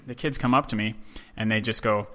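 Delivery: ordinary talk, nothing marked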